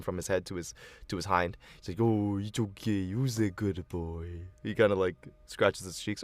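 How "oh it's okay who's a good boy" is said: soft, slow soothing voice; calm the puppy gently. Acted. Soothing the pup.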